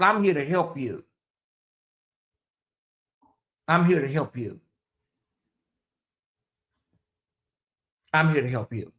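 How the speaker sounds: soft, fading reassurance; soothing. Real, not acted. Telling the caller after the rebuke, soft and reassuring.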